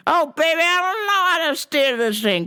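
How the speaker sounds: granny voice. Retold or imitated. Voicing Weed Granny, stuck.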